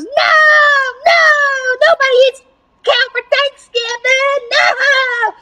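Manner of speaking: high, loud hammed-up crying protest; panicked wail. Cow finding out she is dinner, high panicked wailing. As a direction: wail in desperate comic horror.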